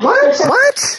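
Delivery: high, incredulous squeak of disbelief; baffled what. Real, not acted. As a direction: react in total disbelief. Baffled by the double negative.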